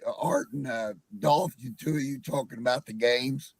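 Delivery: ordinary talk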